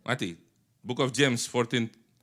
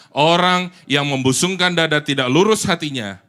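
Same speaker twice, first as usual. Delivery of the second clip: loud group reading; 4 semitones higher, 10 dB louder than usual. Real, not acted. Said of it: "sama-sama baca".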